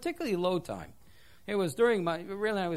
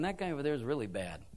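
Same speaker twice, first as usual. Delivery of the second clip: low, quick, hushed con's gossip; a hint of a self-righteous convict; prisoner pointing fingers; 9 semitones lower, 4 dB quieter, faster than usual. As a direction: lightly voice an inmate judging others. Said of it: "he's pointing across the room and saying".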